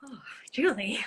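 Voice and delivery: whispered, admiring Oh, Julie; whispered fond sigh. Retold or imitated. Co-star hearing Julie speak Spanish, fond whisper. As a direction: breathe it out in a whisper, smitten. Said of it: "I'm like".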